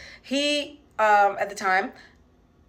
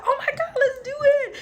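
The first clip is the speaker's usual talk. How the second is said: much higher, squealing with laughter; excited squeal; 4 dB louder than usual. Retold or imitated. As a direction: gush like an overjoyed friend. Rebecca eagerly agreeing.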